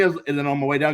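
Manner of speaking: normal talking voice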